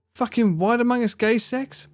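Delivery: usual speaking voice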